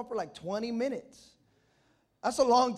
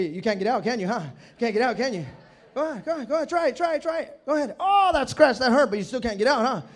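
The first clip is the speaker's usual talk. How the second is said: loud, rapid-fire goading big brother; mock taunting; 4 semitones higher, 10 dB louder, faster than usual. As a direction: goad the pinned sister loudly. Brother taunting pinned sister.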